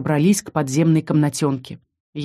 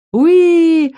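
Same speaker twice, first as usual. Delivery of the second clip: high, loud happy dragon squeal; baby dragon squeal; 11 semitones higher, 7 dB louder than usual. Acted. Little dragon Haven squeals eagerly at the heroine. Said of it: "в ответ ей сказал дракончик".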